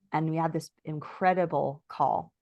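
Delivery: ordinary delivery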